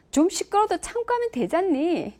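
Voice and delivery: put-on an irritated mom brushing off her child; irritated mother. Mother brushing off a child's complaint, irritated. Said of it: "이 억양부터가 이제 ... 짜증이 나".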